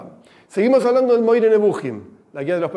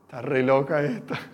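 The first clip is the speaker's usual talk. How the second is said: soft muttered aside to herself; inner thought; 5 semitones lower, 6 dB quieter than usual. Retold or imitated. Calm girl thinking about the teacher, quiet inner aside. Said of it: "por adentro, ¿no?".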